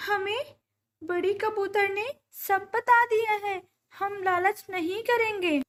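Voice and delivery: high, earnest bird voice; pleading mother bird. A narrator's reading. Bird asking the grapevine for children's food, pleading. Birds petition the magic vine.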